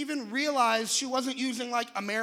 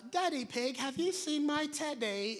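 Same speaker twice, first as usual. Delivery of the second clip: British cartoon accent; 3 semitones higher, 5 dB quieter than usual. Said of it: "Peppa was like".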